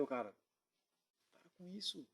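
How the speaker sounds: imitating a very quiet plea; hushed, fearful warning. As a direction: barely voice it, urging someone to stop. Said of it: "Falando com ele baixinho, porque com muito medo".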